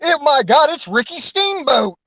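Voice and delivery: mock astonished crowd; surprised fan. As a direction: gasp at the unmasking.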